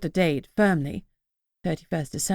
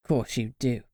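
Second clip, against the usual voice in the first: lower, softer gruff grumble; a grudging low grumble; gruff old grandad; gruffly; 6 semitones lower, 5 dB quieter than usual. A narrator's reading. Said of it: "he said gruffly".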